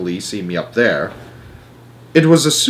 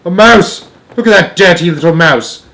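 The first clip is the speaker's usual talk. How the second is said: high, loud cry of alarm; a man raising the alarm, loud and high; alarmed yell; 6 semitones higher, 6 dB louder than usual. A narrator's reading. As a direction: yell out a shocked warning. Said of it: "I heard a man's voice yelling".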